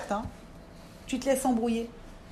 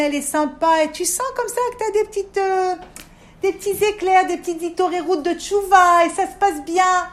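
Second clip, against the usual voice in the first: rising bright excitement, louder and higher; growing higher, louder excitement; excited; 5 semitones higher, 5 dB louder than usual. Retold or imitated. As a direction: sweep them up in the joy of progress. Describing a happy spiritual high.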